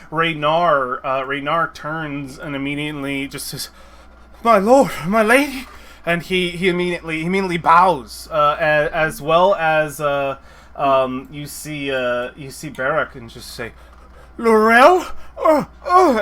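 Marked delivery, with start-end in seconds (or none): 4.4-5.7 loud, high, eager cry of respect; a startled knight hailing his lady; eager greeting. Acted. 14.4-16.2 loud, high, astonished name-call; surprised cry of a name; startled recognition. Acted. Barak recognises the cloaked woman.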